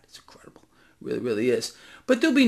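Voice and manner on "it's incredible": hushed, breathless aside; whisper. Real, not acted. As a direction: whisper it in disbelief. Aside after manipulation remark.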